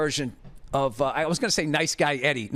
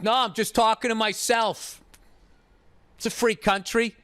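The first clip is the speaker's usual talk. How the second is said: smug dismissive dad; 6 semitones higher than usual. Retold or imitated. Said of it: "And he's like".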